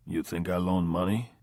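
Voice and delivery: put-on quiet guttural Russian accent; lightly voiced Russian gangster, guttural; Russian loan shark brushing off the question in a guttural Russian accent. A narrator's reading. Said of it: "the tone quiet, guttural".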